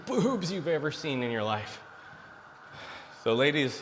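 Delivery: usual speaking voice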